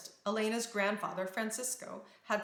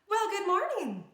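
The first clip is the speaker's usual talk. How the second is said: bright, high princess voice; cheerful greeting; 11 semitones higher, 6 dB louder than usual. A narrator's reading. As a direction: greet friends with a smile. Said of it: "greeted them with a smile".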